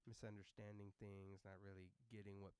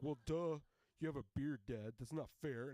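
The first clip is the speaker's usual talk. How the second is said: loud, higher mock-indignant child; protesting kid; 4 semitones higher, 12 dB louder than usual. Kid protesting to bearded dad, loud mock indignation. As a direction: object like an outraged kid.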